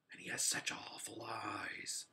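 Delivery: spooky hushed whisper. A narrator's reading. Boy scaring Polly with a whisper. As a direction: whisper it like a secret fear. Boy telling a creepy secret.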